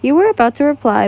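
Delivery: usual speaking voice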